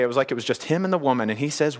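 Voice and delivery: ordinary talk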